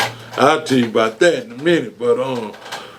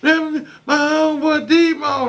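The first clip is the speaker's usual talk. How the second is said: much higher imitated girl voice; man imitating a girl announcing her homeboy D-Mo; girl's voice; 14 semitones higher than usual. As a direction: mimic a girl calling him her homeboy. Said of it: "one of the girls will step out".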